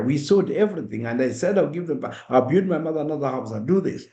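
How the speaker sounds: ordinary talk, nothing marked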